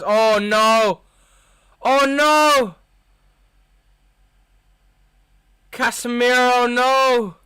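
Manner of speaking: high, loud cries of despair; anguished shout. Real, not acted. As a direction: yell it in horror at the screen. Watching a costly mistake.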